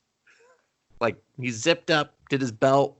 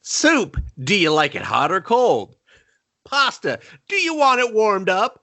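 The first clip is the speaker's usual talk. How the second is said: much higher, goofy sing-song mock voice; mock the dumb catchphrase way up high; hammed-up imitation of the gimmick character; silly mock gimmick voice; 6 semitones higher than usual. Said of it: "he's just like".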